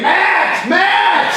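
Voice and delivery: high, loud mock shout of the name "Matt"; imitated yelling. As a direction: call out "Matt" like a frantic friend, laughing. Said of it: "I heard you yelling my name".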